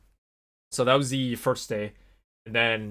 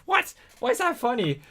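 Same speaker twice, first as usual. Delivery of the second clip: high-pitched, louder baffled protest; mock indignation; 14 semitones higher, 5 dB louder than usual. Student protests at the laughing bus driver, mock indignant. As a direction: squeal it in mock disbelief.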